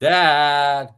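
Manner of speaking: high, loud, put-on voice calling out to the heavens; hollering up to an imaginary father; mock call to Dad. Mocking Mario, calling out to his Dad in a high, loud put-on voice.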